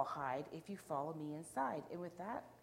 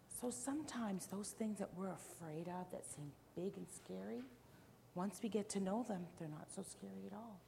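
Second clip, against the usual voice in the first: usual speaking voice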